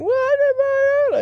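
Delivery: hammed-up shouting from the boat; mock crew shout. Crew on boat yelling at swimmer, mock high shout. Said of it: "they're pointing and going".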